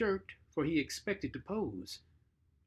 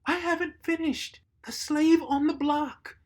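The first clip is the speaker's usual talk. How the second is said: much higher, louder protest; crying out; 12 semitones higher, 7 dB louder than usual. Acted. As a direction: protest aloud in a high voice. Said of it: "Anne cried".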